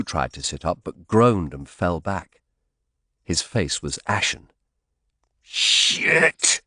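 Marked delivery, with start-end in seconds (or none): 5.4-6.6 thick, choked curse in pain; said thickly. Acted. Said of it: "he said thickly".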